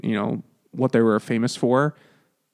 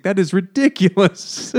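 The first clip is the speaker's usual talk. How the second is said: put-on higher-pitched German accent; 7 semitones higher than usual. Freud dismissing his patient's complaint, in a mock German accent. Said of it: "the German accent".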